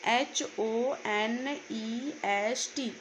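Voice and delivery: each letter spelled slowly and clearly, one by one; slow spelling out. Shown as a demonstration. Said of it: "इसको आप लोग क्या पढ़ते हो?". (अक्षर-अक्षर धीरे-धीरे बोलना)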